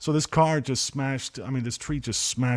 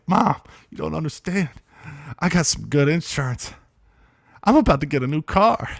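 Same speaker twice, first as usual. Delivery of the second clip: raised, louder, acted-out elation; retold excitement; 5 semitones higher, 6 dB louder than usual. Son to upset mom about insurance and a new car, excited. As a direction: reenact delighted insistence to a parent. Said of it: "I was so excited".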